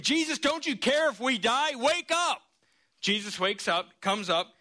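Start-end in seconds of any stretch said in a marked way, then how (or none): 0.0-2.4 panicked screaming. Acted. Frightened disciples screaming at sleeping Jesus. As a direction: cry out in terror as the boat sinks. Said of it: "they start screaming".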